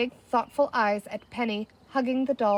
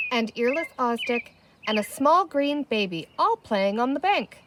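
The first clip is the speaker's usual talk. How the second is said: ordinary delivery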